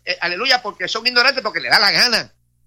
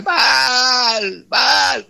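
mock crying out; 4 semitones higher than usual. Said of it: "comenzaron a gritar".

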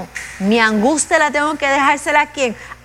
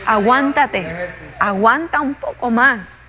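drawn-out, slow exhortation to hold on; slow, deliberate "Aguántate" like steadying someone; slow urging; slower than usual. Acted. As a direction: stretch out "aguanta un poco más" slowly and firmly. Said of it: "exhorting congregation to hold on".

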